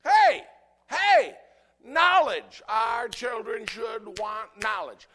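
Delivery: high, loud, slow cry; preaching shout. Real, not acted. Preacher shouting to the church about knowledge. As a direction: shout it from the pulpit.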